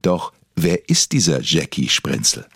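normal talking voice

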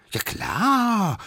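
high, drawn-out agreement; high character voice, breezily sure; eager little one. Acted. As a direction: squeak it out, stretching the last word. Smallest drumstick agrees readily.

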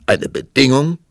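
loud, high outraged king; roaring tyrant king. Acted. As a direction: shout it like an offended ruler. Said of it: "rief der König aus".

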